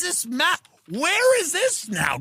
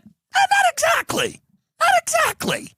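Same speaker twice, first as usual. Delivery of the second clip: very high, loud not exactly; mock squeak; 3 dB louder than usual. Comic understatement on the war, high squeal.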